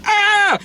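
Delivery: high mock scream; short shriek imitating frightened kids; imitated kids' scream. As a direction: pretend startled yelp, very high. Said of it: "All the kids are like".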